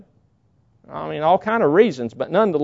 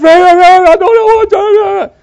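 high, loud panicked screaming; frantic screaming; 13 dB louder than usual. Retold or imitated. Sister calling her brother in a panic, screaming loud. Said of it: "screaming so loud I couldn't understand her".

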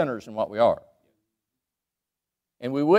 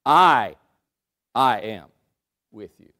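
"I, I am with you" with the first "I" pushed louder and set apart; stress on the double I; 4 semitones lower, 6 dB louder, slower than usual. Shown as a demonstration. Preacher showing the two I's in the verse, stressing "I". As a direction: lean on "I" twice, pausing after each. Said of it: "it has two I's, really".

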